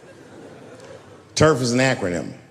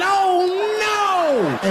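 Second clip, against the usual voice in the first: high mock shriek of horror; horrified; 3 dB louder than usual. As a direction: yelp it, appalled. Seeing the knuckles, horrified.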